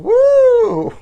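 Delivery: loud, high, long whoop; celebratory woo. Real, not acted. Comic cheering Seattle Pride, loud high woo. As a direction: belt out a piercingly high "woo". Cheer after pa diem chant.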